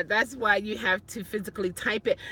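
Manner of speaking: ordinary delivery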